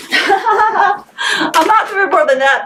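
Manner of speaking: laughing through it, slow and drawn out; laughing refusal. Real, not acted. Laughing as she tells Jake she's done writing. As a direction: giggle while you refuse.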